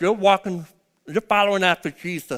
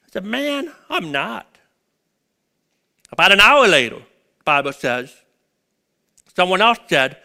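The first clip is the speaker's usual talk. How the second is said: preaching at full force; 3 semitones higher, 5 dB louder than usual. Acted. Preacher to church, telling Peter's denial in a loud preaching voice. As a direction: retell the denial scene, preacher to congregation. Preaching the gospel account aloud.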